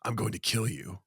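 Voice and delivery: lightly voiced menacing superhero; a hint of low-key, quiet threat; Batman. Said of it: "Batman sees Joker, and what does he say?".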